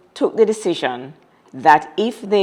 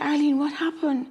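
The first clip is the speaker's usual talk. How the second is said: soft, higher worried ask; worried question; 9 semitones higher, 7 dB quieter than usual. Retold or imitated. [name] asking crying Arlene, soft and worried. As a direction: ask gently, much higher and hushed. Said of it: "So I said".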